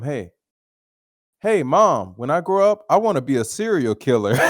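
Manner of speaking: put-on a proud kid announcing a big plan; excited kid calling. Little boy telling his mom his dream in the store, eager and loud.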